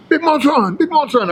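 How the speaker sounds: high-pitched retold greeting; imitated hailing greeting. Gym desk guy greeting a regular at the door, imitated hail.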